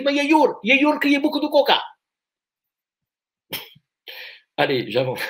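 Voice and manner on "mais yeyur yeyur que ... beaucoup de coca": higher, hammed-up Spanish accent; fake Spanish accent. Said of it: "bloqué sur l'accent espagnol". (accent espagnol forcé, plus aigu)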